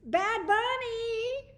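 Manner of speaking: welcoming a viewer who just joined, with an excited shout; excited greeting. Real, not acted. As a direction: call out to a friend with delight. Greeting a viewer by screen name.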